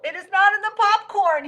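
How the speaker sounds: very high, loud panicked shout; frantic shout. Acted. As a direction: shout it at the top of the range. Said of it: "he shouted".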